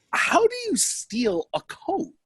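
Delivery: lightly voiced naive coat checker; comic naive storyteller. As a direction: play the wide-eyed innocent.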